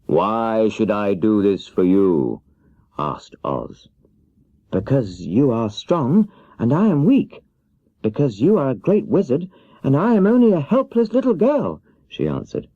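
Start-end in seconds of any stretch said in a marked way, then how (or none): 0.1-2.4 slow, weighty wizard question; the great Oz questioning the little girl, slowly. Acted. Oz asks. 4.7-11.8 put-on much higher, earnest little-girl voice; little girl pleading for help; young Dorothy.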